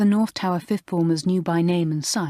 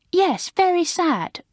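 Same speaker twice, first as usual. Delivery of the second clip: high, prim, gushing schoolgirl voice; prim new girl; 12 semitones higher, 3 dB louder, slower than usual. Acted. Maureen, alarmed, telling the fifth formers off, high and prim. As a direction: play the prim girl shocked at the idea.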